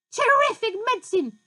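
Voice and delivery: high, loud gleeful whoop; shouted whoopee. A narrator's reading. Grandma shouting with joy as she rises. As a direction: whoop it with wild glee. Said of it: "she cried".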